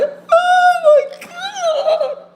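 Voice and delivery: very high, drawn-out wail, loud; mock bawling. Woman acting out crying alone, hammed-up high wail. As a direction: wail it out like an exaggerated breakdown. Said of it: "one day I just started crying".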